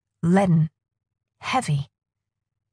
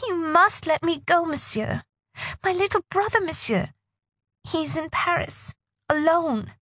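put-on high pleading girl voice; 10 semitones higher, 4 dB quieter than usual. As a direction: beg softly as a young girl. Narrator voices young girl.

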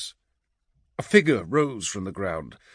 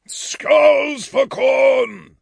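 drawn-out, high battle shout; 9 dB louder, much slower than usual. A narrator's reading. Orto rallies the blood slaves, shouted war cry. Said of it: "shouted Orto".

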